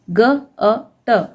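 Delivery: slow, separate sounds ग, अ, ट; sounding out letters. Shown as a demonstration. Teacher sounding out a word for pupils, slow and separated. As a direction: spell out गट sound by sound, slowly. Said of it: "गट".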